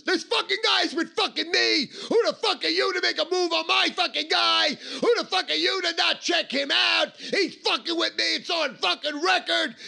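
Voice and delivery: high, loud, furious confrontation yell; full-throated, high-pitched angry shouting; screaming. Retold or imitated. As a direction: scream threats across the room at a rival. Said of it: "all they fucking did was scream".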